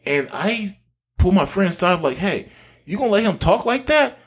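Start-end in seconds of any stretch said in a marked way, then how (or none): 2.2-4.2 much higher, incredulous retold question; indignant challenge. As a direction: challenge a friend in disbelief, pitched high. Confronting his friend, retold.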